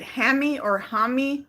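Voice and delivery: "Hammy" with a as in ham, "Hami" with ah; two pronunciations compared. Shown as a demonstration. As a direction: say "Hammy" like ham, then "Hami" like HAH-mee. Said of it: "I don't know if you pronounce it".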